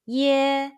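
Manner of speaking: "ye" high and level; high first tone. Shown as a demonstration. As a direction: hold "ye" on a steady high note. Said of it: "and the high pitch first tone".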